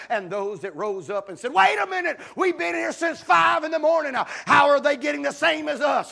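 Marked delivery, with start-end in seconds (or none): 1.5-6.1 higher, louder indignant protest; complaining laborers. Acted. Early laborers protesting the equal pay, high and indignant. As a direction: whine like cheated workers.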